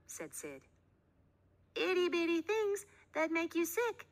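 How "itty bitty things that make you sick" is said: put-on high sweet girl; little girl voice. A narrator's reading. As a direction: talk like a small girl. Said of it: "said May".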